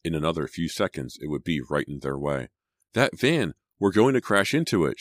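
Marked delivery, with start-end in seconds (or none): 2.9-5.0 high, alarmed cry; terrified exclamation, short of a full scream; narrated scream. A narrator's reading. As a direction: scream a warning in panic. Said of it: "she screamed".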